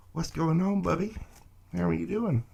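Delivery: high, cutesy pet voice; pet-talk voice. Pretend. Reader greeting the Leo kitty cats, high pet talk. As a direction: coo up high as if to a cat.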